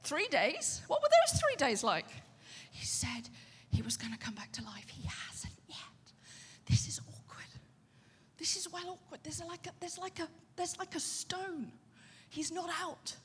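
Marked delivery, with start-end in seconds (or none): 2.7-7.4 whispered, nervous disciples; softly whispered mock panic; frightened whisper. Disciples fearful in story. 8.4-13.2 softer, fretful murmur; hint of embarrassed disciples, hushed; quietly worried, a touch higher; anxious hushed disciples. Disciples voiced in story.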